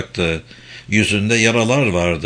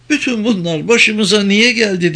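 high, understated sobbing question; a touch of a sob in a raised pitch; tearful; 9 semitones higher than usual. A narrator's reading. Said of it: "diye hıçkırdı".

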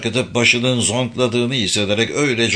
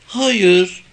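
put-on high little girl's no; small girl's high-pitched reply; child character; 9 semitones higher, 3 dB louder than usual. A narrator's reading. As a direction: answer in a raised child voice. Little girl answers in narration.

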